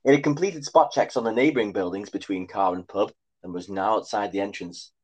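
ordinary delivery

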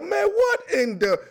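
a shocked gasp of a question, cut off; stunned reaction. Retold or imitated. Imitating a fan seeing the Titans' coach, a high stunned reaction. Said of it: "You're like".

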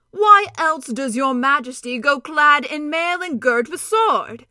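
loud, slow, booming centaur voice; a proud warrior ready for battle; noble centaur. Acted. Centaur Glenstorm speaks.

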